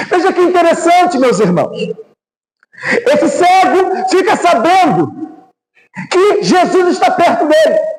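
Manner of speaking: high-pitched, full-force sermon delivery; impassioned preaching. Real, not acted. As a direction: preach it out with urgent intensity.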